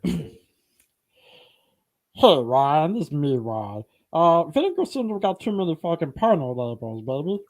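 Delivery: put-on cartoon character voice; Meatwad. Host shows off a cartoon impression, goofy character voice. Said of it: "I can do Meatwad from Aqua Teen".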